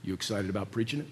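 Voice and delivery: low, hushed Brooklyn imitation; Brooklyn accent. Professor asking a student about preaching, low Brooklyn accent. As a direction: quietly needle him in put-on Brooklyn. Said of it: "He's got this Brooklyn accent".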